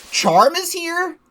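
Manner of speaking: high, slow disbelieving question; stunned she just showed up; surprised. Real, not acted. Player seeing a boss appear, shocked.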